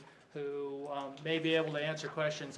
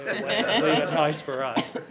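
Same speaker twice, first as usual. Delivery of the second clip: laughing; 8 dB louder than usual. Real, not acted. Joking that outsiders should stay away.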